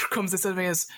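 ordinary talk, nothing marked

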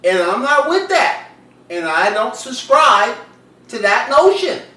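loud, deliberate oration with long pauses; emphatic, performed outrage; indignant declaration. Acted. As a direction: project each phrase like a formal rebuttal. Indignantly reciting his rebuttal message.

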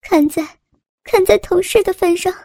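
high, crying plea; tearful pleading. A narrator's reading. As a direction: beg for mercy through tears. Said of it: "痛得眼泪夺眶而出".

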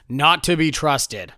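slowly, word by word, deadpan; slow ominous deadpan; slow deadpan warning. Real, not acted. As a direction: drag it out as a dry verdict. Dry warning about Vikings.

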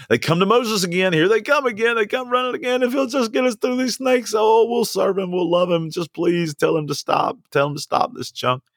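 high, breathless pleading of the people; high frantic plea to Moses; excited retelling. Acted. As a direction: cry it out like a frantic crowd.

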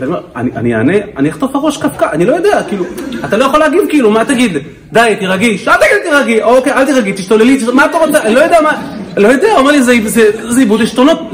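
high, loud, hammed-up panic; panicked husband. Husband begs counselor for guidance, in mock panic. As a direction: play a flustered man losing it, words tumbling out. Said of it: "זה פחד מוות".

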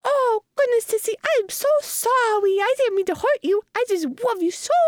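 put-on very high, babyish gushing apology; Franklin, apologizing. Shown as a demonstration. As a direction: plead like a sorry baby brother, way up high. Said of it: "other things I say is like".

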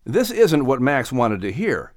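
plain reading voice

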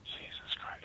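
breathy under-the-breath whisper; muttered aside. Retold or imitated. John muttering to himself at the producer, whispered. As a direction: mutter it to yourself in disbelief. Muttered reaction in retold meeting.

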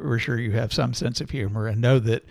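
usual speaking voice

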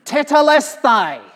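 slow, high, loud cry of triumph; a ringing shout of completion; loud triumphant cry. Acted. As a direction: proclaim the word Tetelestai slowly and loudly. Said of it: "cried out in a loud voice".

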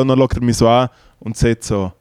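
ordinary delivery

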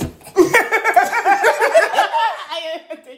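loud, high-pitched laughing fit; burst of laughter. Real, not acted.

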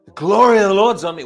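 loud, triumphant declaration. Real, not acted. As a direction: shout it out as your own claim. Preacher declares answer to question.

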